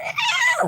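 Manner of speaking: very high shrieking scream; demonic kid scream. Retold or imitated. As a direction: let out a piercing screech. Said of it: "the kids start screaming some loud noises".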